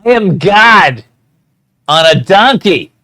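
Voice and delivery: loud, drawn-out godly declaration; grand proclamation. Acted. Player declaring himself God on a donkey, grand and loud. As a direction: proclaim it slowly at full voice.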